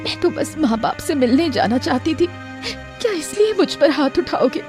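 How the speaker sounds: crying, wounded protest; tearful. Acted. Wife hit for asking to visit parents, tearful. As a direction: sob out the reproach. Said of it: "बेचारी शारदा रोते हुए".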